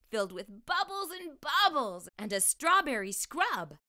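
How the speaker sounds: plain reading voice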